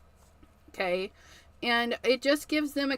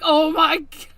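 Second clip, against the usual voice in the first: surprised; 4 semitones higher, 9 dB louder than usual. Retold or imitated. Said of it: "And I'm like".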